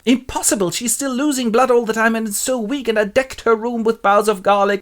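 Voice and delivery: high, put-on agitated voice; exasperated professor. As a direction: lament in hammed-up disbelief. Van Helsing despairs over Lucy.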